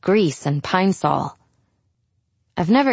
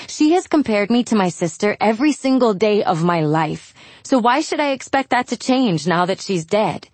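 plain reading voice

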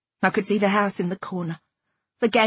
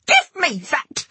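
high, drawn-out indignant yell; narrated yell of outrage; angry yell; 7 semitones higher, 3 dB louder, slower than usual. A narrator's reading. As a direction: yell it high and slow, demanding it back. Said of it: "I yelled".

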